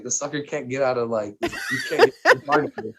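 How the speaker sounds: laughing through the words. Retold or imitated. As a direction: crack up while talking about dad. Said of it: "I'm just, like, laughing".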